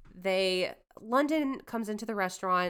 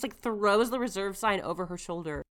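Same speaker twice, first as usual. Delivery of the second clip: ordinary talk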